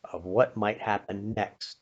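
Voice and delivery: ordinary talk, nothing marked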